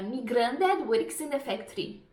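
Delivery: slow Scouse, strong R; Scouse accent, slow; Liverpool accent. Shown as a demonstration. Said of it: "única frase que eu sei do sotaque de Liverpool".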